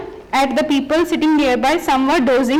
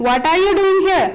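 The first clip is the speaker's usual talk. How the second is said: a hint of a scolding father, higher; 5 semitones higher, faster than usual. A narrator's reading. Father finds his sons on the march, mildly scolding.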